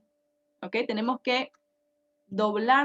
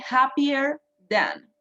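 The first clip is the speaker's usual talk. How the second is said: happier than said slowly and clearly; slow model phrase; slower than usual. Shown as a demonstration. Teacher models happier than slowly for students. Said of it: "What can you say about this?".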